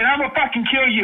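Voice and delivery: a raging father threatening over the phone; furious threat. Real, not acted. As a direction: caller screaming a death threat. Threatening caller yelling down phone.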